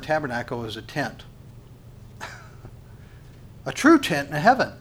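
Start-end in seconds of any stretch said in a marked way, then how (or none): none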